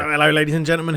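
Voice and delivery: accidental Cockney match greeting; quick, loud greeting slipping into Cockney. Real, not acted. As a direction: let a bit of Cockney creep in. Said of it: "proper Cockney, that, wasn't it".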